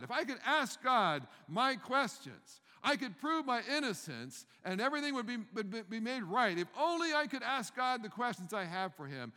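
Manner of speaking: high, loud pleading demand; desperate outcry. Retold or imitated. Job demanding to question God, loud and high. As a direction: cry it out as if begging for a hearing. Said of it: "he even says".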